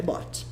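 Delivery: "bought" said softly as "bawt"; soft one-syllable "bought"; silent GH. Shown as a demonstration. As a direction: say "bought" without any G or H sound. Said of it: "mas e o G e H".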